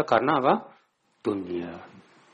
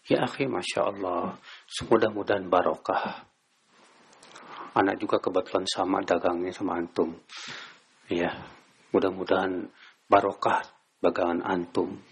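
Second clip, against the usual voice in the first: lower, softer, kindly wishing blessings; gentle brotherly blessing; 7 semitones lower, 3 dB quieter than usual. Retold or imitated. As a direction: speak softly like greeting a brother warmly. Acted kind greeting to rival.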